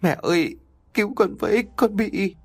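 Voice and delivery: high, broken plea to her mother; bursting into tears. Acted. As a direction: cry out for help through tears. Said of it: "Hạnh òa lên khóc".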